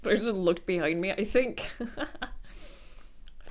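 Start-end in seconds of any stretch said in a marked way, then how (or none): 1.7-3.5 laughing. Real, not acted. Laughing while looking at pet.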